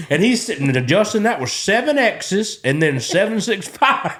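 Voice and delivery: ordinary talk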